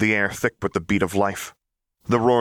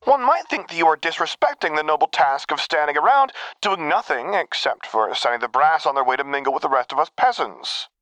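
mock theatrical; 8 semitones higher than usual. Mock-grand theatrical scolding.

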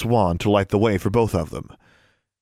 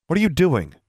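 sharp, higher-pitched question; demanding; 7 semitones higher than usual. A narrator's reading. Girl frowning at him as he lowers wand, demanding. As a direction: demand an answer, pitch jumping up. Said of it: "she demanded".

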